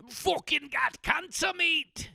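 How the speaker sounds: put-on slow, high-pitched shouted English accent. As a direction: bellow it as a hammed-up Englishman's accent. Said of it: "I'm really good at accents".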